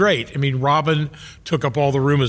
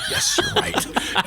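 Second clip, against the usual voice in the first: very high, slow, laughing squeak; squeaky defeated concession; 4 dB quieter, much slower than usual. Retold or imitated. Young comic admitting his cynical friend is right, squeaky laughing. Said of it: "And I'm like".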